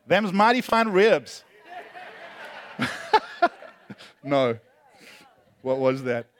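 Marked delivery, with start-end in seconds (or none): 0.1-1.4 mock Arkansas drawl, slow and louder; a playful put-on Arkansas accent. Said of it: "I'm not gonna do the Arkansas accent". 1.7-4.2 silent shaking laugh. Real, not acted. Laughing at own impression.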